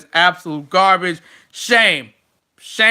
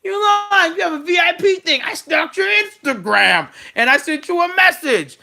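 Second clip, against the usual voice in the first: mocking Sami Zayn; 10 semitones higher than usual. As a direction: yell the accusations like a paranoid conspiracy guy. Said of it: "just throwing things out there".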